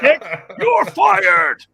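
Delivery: slow, high laughing shout. Retold or imitated. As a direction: bellow it through laughter. Quoting film boss firing.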